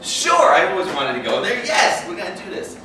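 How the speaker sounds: loud, high burst on the first word; acted teenage enthusiasm; eager agreement. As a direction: jump at the offer like an excited kid.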